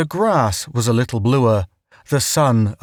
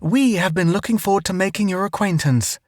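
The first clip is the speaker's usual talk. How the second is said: high, fast formal welcome; sweet talk a stranger; overly polite; 7 semitones higher, faster than usual. A narrator's reading. Formal greeting to native.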